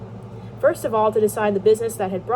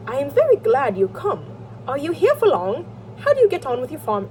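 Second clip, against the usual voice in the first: higher, louder, badly faked British accent; attempted British accent; 5 semitones higher, 4 dB louder than usual. Brother greets his stepbrother, in a badly faked British accent. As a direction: put on a shaky attempt at British. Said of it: "I can't fucking do the accent".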